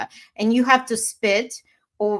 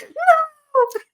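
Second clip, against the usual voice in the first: high, loud shocked cry of "No"; shocked shout; 5 dB louder than usual. Retold or imitated. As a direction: yell it in alarm. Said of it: "I'm like".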